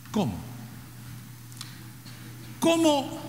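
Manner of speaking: usual speaking voice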